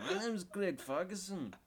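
bouncy Scottish impression; Craig Ferguson. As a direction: play a cheeky Scottish TV host. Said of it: "then there's Craig Ferguson".

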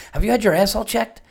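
high, quick, earnest imitation of a friend; mock-serious friend asking; dead serious question. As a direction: ask it dead seriously, squinting with interest. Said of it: "he didn't say it jokingly".

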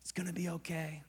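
whispered, barely voiced comfort; hushed breath of reassurance; failing father's reassurance. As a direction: imitate a sick dad's weakened voice, very softly. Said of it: "His voice was already starting to change".

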